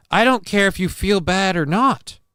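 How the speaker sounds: high-pitched, indignant retort; pitched well up, ending on a rise; emphatic retort. Real, not acted. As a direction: fire it back high, rising at the end. Firing back at a chat comment.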